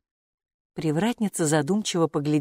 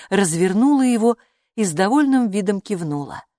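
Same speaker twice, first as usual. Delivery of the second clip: ordinary delivery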